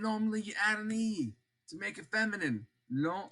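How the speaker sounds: ordinary talk, nothing marked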